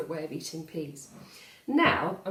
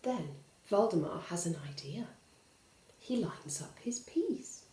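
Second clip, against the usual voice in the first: secret conspiratorial whisper; 2 semitones lower, 5 dB quieter than usual. A narrator's reading. Narrator hinting at Valdemar's trick to the children, whispered.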